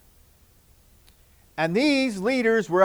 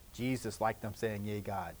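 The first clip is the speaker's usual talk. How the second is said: hushed aside; 9 semitones lower, 15 dB quieter than usual. Real, not acted. Tender aside to children.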